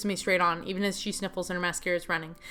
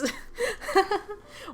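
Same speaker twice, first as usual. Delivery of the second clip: squeaky high laugh through tears; high giggle; 12 semitones higher than usual. Acted. Girlfriend laughing after shit happens, high giggle. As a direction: laugh up high, relieved and teary.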